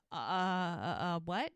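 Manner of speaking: stuck on filler sounds, then a puzzled question; flabbergasted. Retold or imitated. Mel hearing Marley's request, retold flabbergasted. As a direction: imitate stammering, stunned disbelief. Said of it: "Like, flabbergasted".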